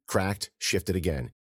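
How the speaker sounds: ordinary delivery, nothing marked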